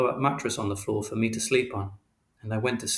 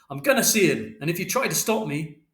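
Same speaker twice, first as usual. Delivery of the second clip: raised, higher-pitched warning; angry threat; 6 semitones higher than usual. A narrator's reading. Father insisting on seeing his son, angry raised voice. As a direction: warn them off forcefully.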